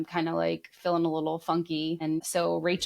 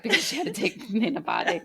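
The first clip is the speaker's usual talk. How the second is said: gossiping doctor, laughing through it; laughing mock gossip; 6 semitones higher than usual. Doctor tells his wife about the crying patient, laughing. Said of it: "went home and told, uh, like, his wife".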